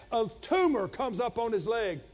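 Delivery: ordinary talk, nothing marked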